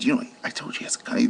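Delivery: breathy hurried whisper, fast; fast urgent whisper to the officers; retold whisper. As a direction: whisper the warning so only the cops hear. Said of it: "when I tried to whisper to the officers".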